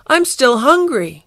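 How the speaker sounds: high, loud complaint; cried plea. Acted. Garfield to the fleeing Cosmots, cried plea. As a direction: call it after someone leaving. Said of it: "cried Garfield".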